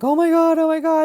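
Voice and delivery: high, loud panicked "oh my God"; shocked gasp. Retold or imitated. Restaurant crowd watching the takedown, imitated in shock. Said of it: "And everyone's like".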